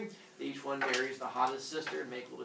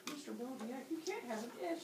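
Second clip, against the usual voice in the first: soft, high put-on student voice; mock pupil correcting the teacher; objecting student; 10 semitones higher, 9 dB quieter than usual. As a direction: pipe up with a small high-pitched objection.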